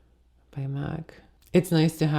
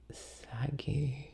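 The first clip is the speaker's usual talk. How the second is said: breathy whisper, a private joke; whispered aside; 11 dB quieter than usual. Real, not acted. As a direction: drop to a whisper for the confession. Self-deprecating aside about aging skin.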